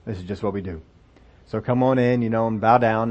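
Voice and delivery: low, soft reassurance; calm them, it's just procedure; quietly reassuring; reassuring official. Acted. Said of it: "tried to reassure him".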